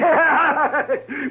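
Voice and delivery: high mocking laugh; drunken laugh. [name] mocks Cam's threat, drunken laugh.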